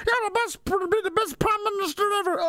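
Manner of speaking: very high-pitched mock child voice; adoring children. Children praising Daddy as best prime minister, squeaky adoring. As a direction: gush in a small child's squeak.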